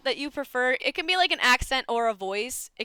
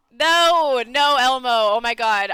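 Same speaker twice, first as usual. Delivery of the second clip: loud protest; 2 semitones higher, 9 dB louder, slower than usual. Real, not acted. Streamer turning down an Elmo voice request, loud protest. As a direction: refuse it at full volume.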